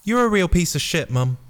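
high-pitched put-on hero voice; disgusted Jack.

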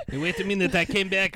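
put-on goofy French-Canadian accent, Borat-like; hammy French accent, halting English; Patrice Bergeron French accent. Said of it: "Patrice Bergeron sound like Sacha Baron Cohen?".

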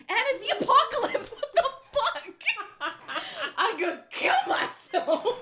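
real giggling frustration; laughing. Real, not acted. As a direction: lose it over the botched take. Second flub of the intro.

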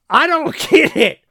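high, loud laugh breaking into the words; disbelieving laugh at the husband's calm; laughing disbelief. Real, not acted. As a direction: laugh it out, can't believe it.

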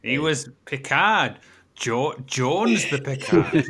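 slow, loud, pressing on Picard; emphatic insistence. Real, not acted. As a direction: drag it out to settle the argument. Insisting on who played Picard.

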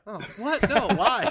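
high, loud shocked protest; whiny teen son. Acted. Son objecting to his mom.

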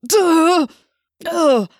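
loud, high strangled cry; agonized grunting outburst; strained cry. A narrator's reading. Chapman crying out as his host rebels, strangled cry. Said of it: "Chapman cried out".

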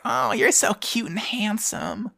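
high, slow, put-on sweet voice; fake flirt. Pilot baiting the smitten enemy ace, a high slow fake flirt. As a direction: coo it to lure him in. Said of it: "and she's like".

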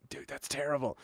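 imitated hushed verdict; retold aside. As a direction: quietly break it to him. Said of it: "he leans over and whispers".

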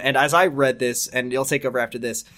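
plain reading voice

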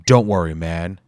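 loud raspy New York tough guy; Bomberhead's raspy New York voice. Acted. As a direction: rasp it out with a New York accent. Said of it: "he said in a raspy voice".

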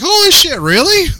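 high, loud mock amazement. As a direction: act floored by the news. Retold surprise at beer.

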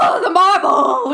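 slow, shocked bad British accent; mock British accent. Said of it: "very bad British accents".

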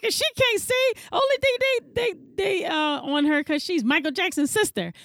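very high catty mom voice; hammed-up gossiping mother; imitating Sissy. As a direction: retell Sissy's jab in a shrill put-on voice. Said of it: "she was like".

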